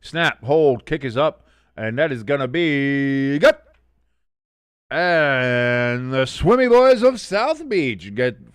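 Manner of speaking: loud, stretched field-goal call; play-by-play. Real, not acted. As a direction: build to a big drawn-out announcer call. Calls the field goal live.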